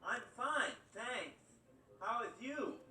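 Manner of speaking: put-on high, spitty little-kid voice; kid with lisp. Ricky answering on day one, high and spitty. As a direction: mimic a kid with a speech impediment. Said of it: "he's like".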